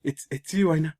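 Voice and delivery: high, mock-delighted recognition; happy. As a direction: call out as if happily finding a lost friend. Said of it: "he's happy. He's like".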